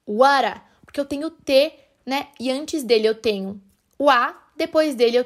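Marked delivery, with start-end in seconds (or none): 0.1-0.6 "What a" run together as "wara"; flapped T. Shown as a demonstration. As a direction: flap the T of "What a" into a quick R. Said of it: "esse what a vira what a". ("What a" falado como "wara")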